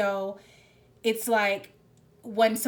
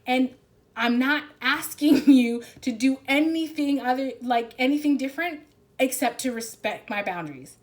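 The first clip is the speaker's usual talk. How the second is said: higher and louder, insisting on being yourself; passionate self-assertion; 5 semitones higher, 7 dB louder than usual. Retold or imitated. Reader voicing the client standing up for themselves, higher and louder. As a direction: declare it with rising fire. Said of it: "you're like".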